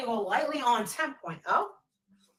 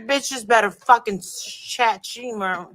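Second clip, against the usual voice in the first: ordinary talk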